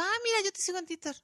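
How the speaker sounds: high, gushing fangirl greeting; gushing fan. Retold or imitated. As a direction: play up the starstruck fan. Repeats her fan greeting.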